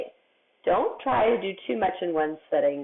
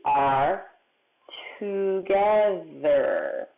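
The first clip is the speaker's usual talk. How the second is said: word-by-word, very slow read-back; slow reading aloud; 2 semitones lower, much slower than usual. Shown as a demonstration. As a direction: read it back slowly, stretching the last word. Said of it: "Let's read what I have".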